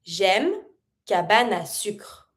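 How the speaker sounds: cabane à sucre with the mute E dropped, cabane running into à; slow model of cabane à sucre; linked French. Shown as a demonstration. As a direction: connect cabane à sucre smoothly. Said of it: "Look at the way I say cabane à sucre".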